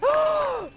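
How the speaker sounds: sharp high gasp of delight; joyful gasp. Acted. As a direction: gasp with sudden joy.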